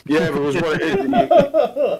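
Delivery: laughing high and slow through the words; can barely get it out for laughing. Real, not acted. Laughing at his mate's story about the trolleys.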